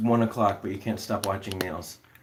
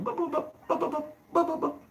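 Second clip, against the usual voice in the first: very high squeaky bup bup bup; guinea pig bupping. Retold or imitated. Showing viewers an annoyed guinea pig, high squeaky bups. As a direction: imitate an annoyed guinea pig's chirps. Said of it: "they start going".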